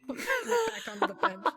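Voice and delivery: laughing through the words. Real, not acted. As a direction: laugh it out, high and loud. Laughing at friend's VR clumsiness.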